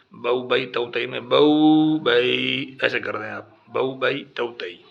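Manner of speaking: "بو بئی تو تئی" read slowly, syllable by syllable; slow teacher's modelling of "بو بئی"; slow syllable drill. Shown as a demonstration. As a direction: model the reading slowly for the child. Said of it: "ٹھیک ہے، الٹا بتائیں".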